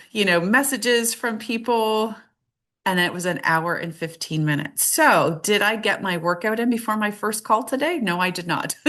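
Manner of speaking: ordinary talk, nothing marked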